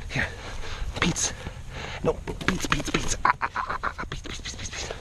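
whispering the name over and over; whispered calling. Real, not acted. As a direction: coax softly in a whisper. Calling to animal softly.

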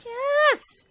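high, loud whooping disbelief; excited whoop. Real, not acted. Player celebrating killing the hive, high loud whoop. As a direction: whoop like you can't believe it.

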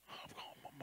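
whispered weak words to his mother; faint whisper. Retold or imitated. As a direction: barely breathe it out. Telling mom he will die.

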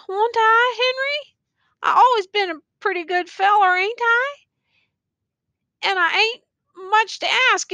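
high, pleading voice of a wounded soldier; dying soldier pleads with his friend; begging soldier. A narrator's reading. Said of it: "continued to beg in a lowly way".

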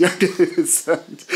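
laughing through the words. Real, not acted. Laughs while repeating own phrase.

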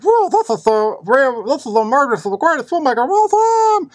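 high, hammed-up gushing; mock fanboy raving. Imagining himself blown away by a movie, hammed-up high raving. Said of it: "It wasn't something where I was like".

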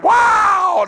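sudden high, loud cry of joy; shouting girl overcome at the altar; joyful shout. Retold or imitated. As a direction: let it burst out high like a church shout. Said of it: "she went to shouting".

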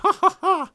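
squeaky mock laugh; high silly ha ha ha; high cartoon laugh. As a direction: cackle like a tiny cartoon fighter. Character laugh.

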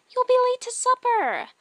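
very high, loud comic voice; loud falsetto-like holler; high-pitched call. Acted.

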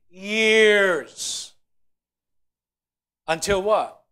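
loud, slow, building sermon cry; preaching at full force. Acted. Preacher thundering to the congregation, loud and slow.

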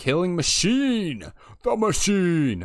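high, loud, drawn-out mock battle cry; hammed-up shout. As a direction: belt out the boast, stretching the word. Hams up a recruit's boast.

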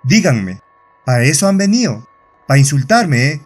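ordinary delivery

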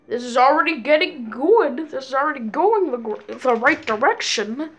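normal talking voice

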